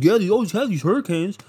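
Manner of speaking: high, goofy voice of a clueless guy on a rooftop; dumb guy voice. Retold or imitated. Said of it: "He's like".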